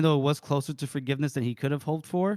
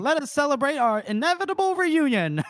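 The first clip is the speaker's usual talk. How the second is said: Casca voice; 11 semitones higher, 3 dB louder than usual. Retold or imitated. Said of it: "I know that tone of voice you're using".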